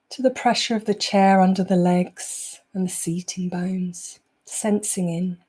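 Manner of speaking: unhurried meditation voice, long pauses between phrases; guided meditation. Acted. Teacher easing the group out of a busy day, guided meditation. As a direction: guide them calmly into the present moment.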